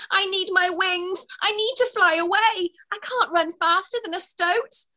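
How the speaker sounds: high, desperate fairy voice. Acted. Fairy Twigs despairs while running from the stoat. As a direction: beg for your wings back.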